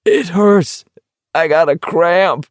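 high, whimpering pained voice. A narrator's reading. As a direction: whine it out through the hurt. Character doubled over in pain.

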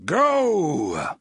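booming from the pipes; thundering command. A narrator's reading. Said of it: "thundered the voice from the pipes".

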